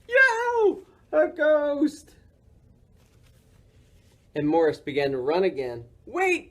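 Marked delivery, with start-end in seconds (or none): 0.1-2.2 frightened yell. A narrator's reading. Morris sees himself in the mirror and yells in fright. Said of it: "Morris yelled". 6.1-6.5 much higher call; shout. A narrator's reading. Morris shouts for his friend to wait. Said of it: "Morris shouted".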